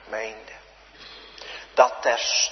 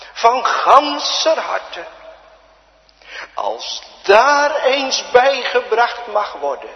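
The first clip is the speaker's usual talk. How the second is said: jubilant preaching; 8 semitones higher, 9 dB louder than usual. Acted. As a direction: lift it up with joy. Preacher swells again.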